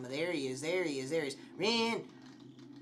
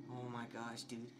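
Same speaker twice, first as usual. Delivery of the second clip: usual speaking voice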